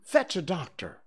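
high, urgent command; cried order. Acted. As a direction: send someone off for help. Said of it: "he cried".

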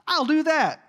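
high, hammed-up gung-ho resolve; mock can-do enthusiasm; mock eagerness. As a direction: pitch it way up, absurdly confident. Mock resolve to copy a miracle.

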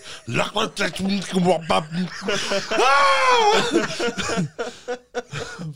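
loud, high gibberish tirade; hammed-up foreign-sounding shriek; mock Russian yelling. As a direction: yell fake angry Russian, ending in a long scream. Mimics angry Russians screaming at him.

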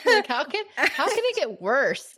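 high pitched, laughing; laughing disbelief. Real, not acted.

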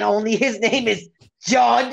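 ordinary talk

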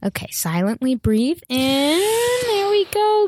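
slow, high soothing guide voice; guided meditation. Acted. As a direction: ease them into breathing slowly.

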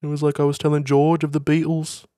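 high put-on Liverpool accent. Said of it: "do the Liverpool accent".

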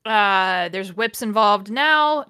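ordinary talk

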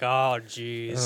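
lightly voiced weary grump; slow, put-upon greeting; Luke. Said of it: "And Luke's like".

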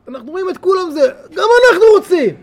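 rising cry of longing. Acted. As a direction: plead like you're left out. Voicing longing crowd.

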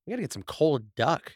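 ordinary talk, nothing marked

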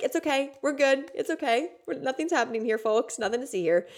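high singsong pretend calm; feigned naive reassurance. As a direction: play naive, insisting all is fine. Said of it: "trying to, like, be naive".

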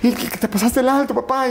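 voz aguda de policía regañando; voz de policía imitada. Retold or imitated. Hijo retrata al policía. (policía imitado con voz aguda)

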